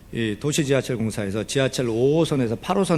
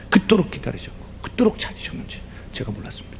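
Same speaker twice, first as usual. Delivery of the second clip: whispered tearful prayer; 4 semitones higher, 6 dB quieter than usual. Retold or imitated. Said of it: "엉엉 울어요".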